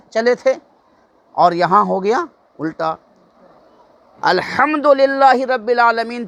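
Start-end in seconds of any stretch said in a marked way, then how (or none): 4.2-6.3 slow, higher recitation of الحمدللہ رب العالمین; Quranic recitation. Shown as a demonstration. Teacher reciting Al-Hamd correctly, in a slow chant. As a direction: draw out the Arabic reverently, in a chant. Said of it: "کتنا بڑا فرق".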